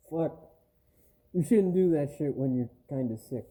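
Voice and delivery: ordinary delivery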